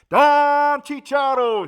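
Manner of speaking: long, high, loud announcer call; drawn-out hype shout. Acted. Shouting out a viewer, long high hype call. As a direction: stretch the name like a ring announcer.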